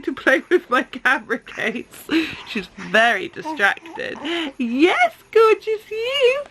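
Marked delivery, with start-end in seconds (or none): 4.6-6.4 high, slow cooing. Real, not acted. Cooing at her baby.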